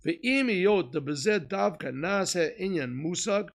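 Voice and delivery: fervent sermon delivery; preaching. Acted. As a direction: preach it out to the room. Rousing the class.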